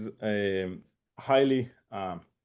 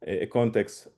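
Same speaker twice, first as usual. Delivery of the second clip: ordinary talk, nothing marked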